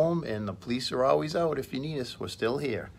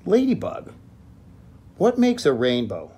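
high, curious little bunny voice; Little Rabbit asking; 7 semitones higher, 8 dB louder than usual. Acted. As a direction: ask like a tiny wondering rabbit. Little Rabbit calls to Ladybug.

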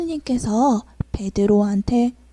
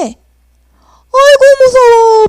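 high, loud panicked wail; scared cry; 9 semitones higher, 8 dB louder, much slower than usual. Acted. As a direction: cry out in fright as you flee. Peter fleeing in fear.

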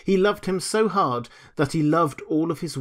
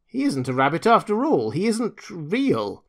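higher, swooping rabbit verdict; exclaiming; 6 semitones higher than usual. A narrator's reading. Wild rabbit denouncing the toy, exclaimed discovery. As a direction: exclaim it, drawing out the last part. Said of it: "he exclaimed".

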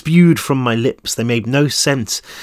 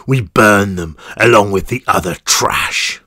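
menacing guard; 4 semitones lower than usual. Acted. Guard threatens prisoner.

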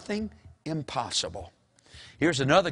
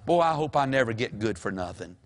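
normal talking voice

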